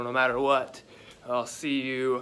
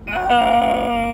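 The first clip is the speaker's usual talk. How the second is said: high, loud gasp of pain; pained cry; 9 semitones higher, 11 dB louder than usual. Real, not acted. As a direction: yelp as the cold bites. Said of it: "brain freeze".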